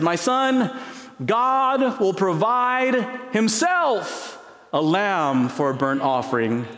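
high, slow, solemn father's reply; Abraham's answer. Acted. Abraham answering his son Isaac, high, slow and solemn. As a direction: draw it out tenderly, father to son.

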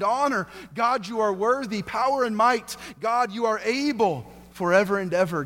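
rising, drawn-out sermon crescendo of worship; building praise litany. Acted.

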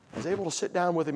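normal talking voice

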